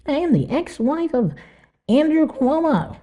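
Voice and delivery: high-pitched mock grand announcement; self-important ex-wife. Host mimicking an ex-wife pulling rank, self-important. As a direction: announce yourself in a high voice, stretching the famous name. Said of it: "you have to say it like that".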